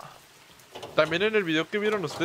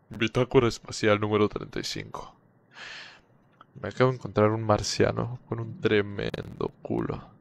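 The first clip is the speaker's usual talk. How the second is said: a hint of a lower, solemn log-reading voice; mock captain's log; 6 semitones lower than usual. Streamer jokingly narrates a space log to viewers, mock solemn. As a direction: lightly play a spaceship captain dictating his log.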